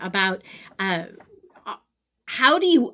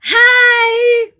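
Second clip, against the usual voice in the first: high, loud, drawn-out squeal; mock kid squeal, pitched way up; excited little boy's greeting; 14 dB louder than usual. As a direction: imitate a small child's gleeful shout. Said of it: "and he's like".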